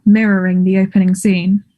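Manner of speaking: ordinary talk, nothing marked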